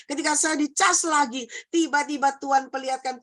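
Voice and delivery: high, insistent testimony; impassioned preacher in full flow; fervent preaching. Real, not acted. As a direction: press the testimony on the congregation with passion.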